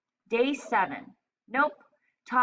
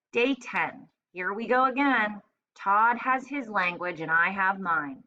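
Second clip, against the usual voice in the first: usual speaking voice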